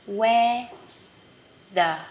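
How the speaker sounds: slow, broken into separate words; spaced-out teaching pronunciation; careful demonstration. Shown as a demonstration. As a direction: model it word by word, drawn out. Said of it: "Từ này hơi khó phát âm".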